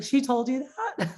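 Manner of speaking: high, laughing she told you that; laughing disbelief. Retold or imitated. Client amused by her friend's compliment, laughing high. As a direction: laugh through the question.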